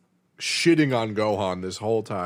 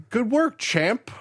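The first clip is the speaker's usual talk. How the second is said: higher, slow sarcastic pat on the back; mock praise; 9 semitones higher, slower than usual. Fighter patronizing Hercule after the fight, slow and sarcastic. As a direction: patronize him slowly.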